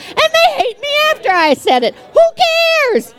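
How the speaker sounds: high, emphatic who-cares retort; defiant dismissal. Real, not acted. Preacher brushing off people who hate her, defiant high outburst.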